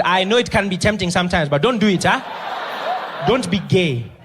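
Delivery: loud earnest Zambian warning; Zambian accent. Retold or imitated. Chaperone Ali warning Trevor, loud Zambian accent. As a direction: press the warning hard. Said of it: "He says".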